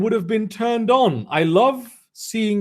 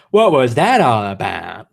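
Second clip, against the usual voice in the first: a clumsy put-on camp voice, lower and louder; 9 semitones lower, 4 dB louder than usual. Fanfic reader voicing the two boys, awkward camp guy voice.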